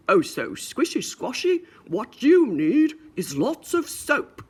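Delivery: mock stern father; hammed-up daddy lecture. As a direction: lay down the rule slowly. Daddy pig's advice.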